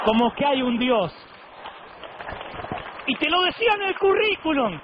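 high-pitched, incredulous exclamation; mock-amazed outburst. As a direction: build to a high comic cry of disbelief. Comic outburst at absurd résumé credit.

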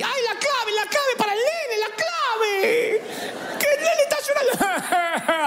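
very high, frantic mom with a laughing sob; panicked doting mother. Retold or imitated. Overprotective mother begging for the wifi password for her son. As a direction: squeal it like an overanxious mother. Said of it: "hay madres así".

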